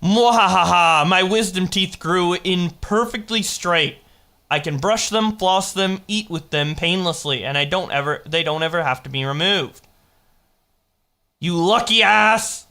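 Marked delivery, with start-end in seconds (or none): 0.0-1.1 slow, loud evil cackle; villain's gloating laugh; mad scientist laugh. Acted. 11.4-12.7 slow, loud mock outrage; drawn-out jealous shout; envious exclamation. Real, not acted. Envious outburst.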